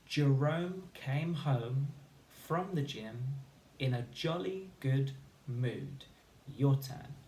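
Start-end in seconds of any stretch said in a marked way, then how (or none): 0.0-6.0 slow choral reading; over enunciating. Shown as a demonstration. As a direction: read slowly with the class.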